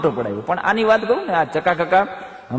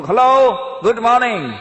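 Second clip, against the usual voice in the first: a bright, louder, higher hello, good morning; loud greeting; 6 semitones higher, 6 dB louder than usual. Acted. Foreigner calling good morning to his neighbour, loud and high. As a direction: call the greeting across the street.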